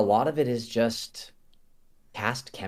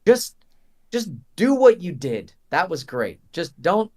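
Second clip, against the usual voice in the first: eager director; 7 semitones higher, 6 dB louder than usual. Retold or imitated. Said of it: "they were like".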